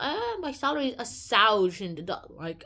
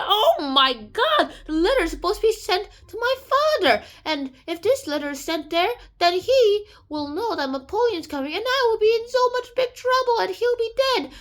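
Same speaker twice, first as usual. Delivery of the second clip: loud, high, escalating alarm; mock panic; 9 semitones higher, 5 dB louder than usual. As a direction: panic theatrically, climbing higher as it goes. Jailer panics about the letter.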